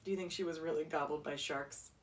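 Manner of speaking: plain reading voice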